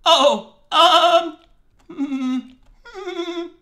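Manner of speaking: high musing goat man hums; satyr voice. Acted. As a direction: hum like a pondering satyr. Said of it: "my satyr voice".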